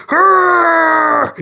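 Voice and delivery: high, shouted pirate-style growl; mock battle roar. The bride throwing a grenade, hammed-up high war cry. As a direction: belt out a cartoon war cry. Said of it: "throwing a grenade behind her and going".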